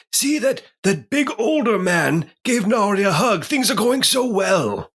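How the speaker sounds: put-on high, cheerful old professor voice with a slight British lilt; Professor Farnsworth. Pine cheerfully misreading the reunion, in a high old professor voice. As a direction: play a doddering old professor delighted with everything. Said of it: "my voice is just a terrible impression of Professor Farnsworth".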